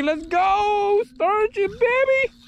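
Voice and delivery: high, thrilled hype; excited cheering. Real, not acted. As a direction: cheer the catch on, pitch way up.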